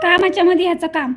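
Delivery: exasperated complaint. Real, not acted. Owner complains to viewers about the pet, exasperated. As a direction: vent the frustration quickly.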